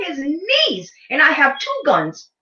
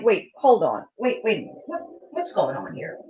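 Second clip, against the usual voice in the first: soft, low, trailing-off disbelief; hushed bewilderment; 6 semitones lower, 6 dB quieter than usual. Retold or imitated. Viewer watching master start crying, hushed bewilderment. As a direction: drop your voice in baffled disbelief. Retold stunned reaction to the film.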